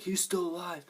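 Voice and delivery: high-pitched astonished quote; acted surprise. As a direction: exclaim it high, like a startled discovery. Onlookers' discovery acted after plain telling.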